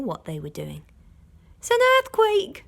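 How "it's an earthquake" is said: much higher, louder frightened cry; alarmed cry. A narrator's reading. Missus Fox crying out to her family in panic. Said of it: "cried Missus Fox".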